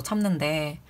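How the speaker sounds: usual speaking voice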